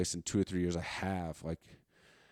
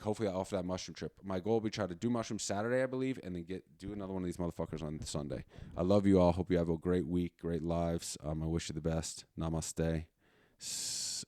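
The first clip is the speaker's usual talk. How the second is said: usual speaking voice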